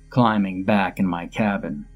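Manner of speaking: plain reading voice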